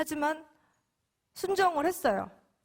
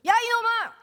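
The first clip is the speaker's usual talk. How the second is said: loud, much higher mock rebuke; mock scolding shout; 11 semitones higher, 8 dB louder than usual. Preacher imagining God scolding her, mock shout. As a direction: bark it like an imagined angry scolding. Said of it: "야단을 치시는 게 아니라".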